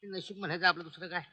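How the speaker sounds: low, soft private grumble; muttering to himself. Acted. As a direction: grumble under the breath while walking off. Constable grumbles to himself on round.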